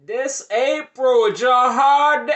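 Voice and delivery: high, slow shouted name call; yelling. Retold or imitated. Girl outside the door shouting to be let in, yelling. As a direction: bellow it through the door like she owns the place. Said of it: "You don't have to yell".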